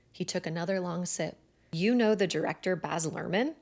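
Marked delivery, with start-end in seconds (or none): none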